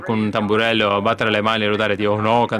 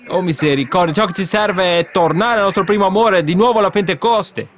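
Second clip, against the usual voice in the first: impassioned plea; 6 semitones higher than usual. Real, not acted. Preacher calling back to Pentecost.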